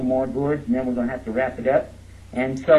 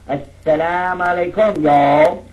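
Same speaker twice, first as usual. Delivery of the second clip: Assalamu alaikum then a very loud Texan y'all; 3 semitones higher, 6 dB louder, slower than usual. Shown as a demonstration. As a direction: joke greeting with a shouted Texas y'all. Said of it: "Muslims from Texas say".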